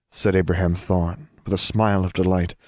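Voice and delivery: usual speaking voice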